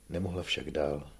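ordinary delivery, nothing marked

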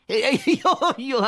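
high giggling laugh, caught out; sheepish chuckle at being caught; embarrassed laugh. Acted.

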